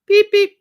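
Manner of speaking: high, loud signal beeps; punchy high-pitched beep-beep; imitated beeping. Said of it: "the thing is going".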